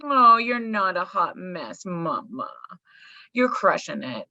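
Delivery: slow, drawn-out consoling; tender reassurance. Real, not acted. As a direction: comfort a friend who is down on herself. Consoling guest who feels a mess.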